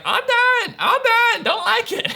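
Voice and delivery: very high, loud imitation of a small child; whining toddler. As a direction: act out a toddler's squealed tantrum. Toddler refusing, voiced by dad.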